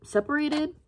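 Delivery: high cracking word as tears come; real sob breaking through one word. Real, not acted. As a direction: let the voice crack upward on separated. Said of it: "I did get emotional".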